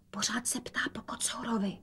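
unvoiced hushed whisper. A narrator's reading. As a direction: whisper so father won't hear. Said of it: "dodala šeptem". (šepotem)

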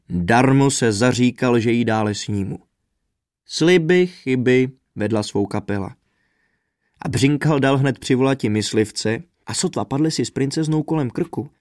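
ordinary delivery

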